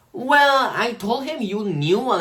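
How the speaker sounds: high, loud sheepish classmate; imitating Peter. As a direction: raise the voice like the kid owning up. Voicing Peter confessing.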